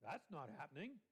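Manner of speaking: low, offhand That's not happening; dismissive aside, dropped low; casual brush-off. Retold or imitated. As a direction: toss it away like everyday slang. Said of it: "you don't want to do it".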